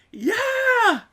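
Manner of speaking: high, loud yelled answer; shouted reply. Retold or imitated. Van driver yelling back to the car. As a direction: bellow it back over the wind. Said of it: "I'm screaming back and forth".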